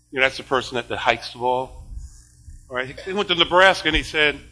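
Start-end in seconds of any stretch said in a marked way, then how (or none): none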